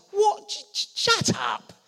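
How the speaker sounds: incredulous sneering yell; scornful brothers. Retold or imitated. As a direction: jeer at the little brother. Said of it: "His brothers".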